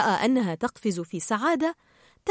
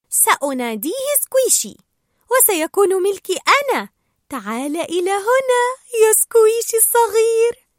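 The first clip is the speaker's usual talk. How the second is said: put-on high baby-talk, climbing higher; Dory, cooing delight; 11 semitones higher, 6 dB louder than usual. Dory claiming the little jellyfish as hers, cooing. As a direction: coo over it as your new pet.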